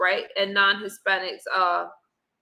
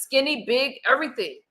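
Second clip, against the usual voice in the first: normal talking voice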